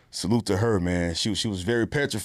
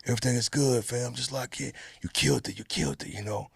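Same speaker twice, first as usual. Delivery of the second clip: a lightly voiced director, softer and calming; easygoing reassurance, softer and a bit quicker; imitating Corey Espy; 3 semitones higher, 5 dB quieter than usual.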